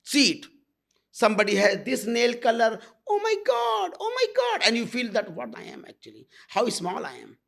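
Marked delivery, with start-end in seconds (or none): 3.1-4.7 high, gushing mock partygoer. Guru mocking a guest who admires nail color, high gushing. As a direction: send up a dazzled guest cooing over nails.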